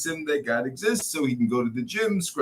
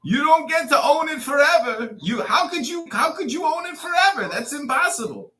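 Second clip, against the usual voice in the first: indignant shout; 8 semitones higher than usual. Real, not acted. Arguing with a speaker about Palestine, shouting indignantly. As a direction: hurl it at him in outrage. Heated argument with a Zionist speaker.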